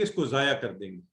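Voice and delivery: ordinary talk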